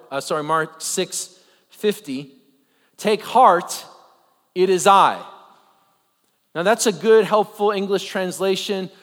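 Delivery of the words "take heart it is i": quoting Jesus. Shown as a demonstration. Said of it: "the language of Jesus in Mark".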